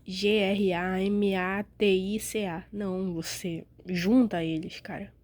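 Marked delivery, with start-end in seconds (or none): 0.1-2.6 each letter of G R A M A T I C A said apart, slowly; spelled letter by letter. Shown as a demonstration. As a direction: break gramática into separate letters, slowly. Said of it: "Você não vai falar".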